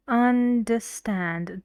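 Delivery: understand with un and stand both hit equally; both stresses in understand. Shown as a demonstration. Teacher demonstrates to students angry understand, slow, un and stand equal. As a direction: say understand slowly, punching un and stand alike. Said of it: "one word and we have two stressed syllables".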